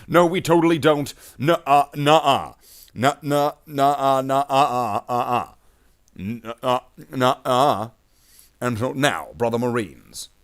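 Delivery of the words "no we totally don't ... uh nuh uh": put-on loud, higher childish nuh-uh protest; hammed-up playground denial, louder and higher; mock loud denial. Bloodhounds chapter denying its Alpha Legion traits, loud mock protest.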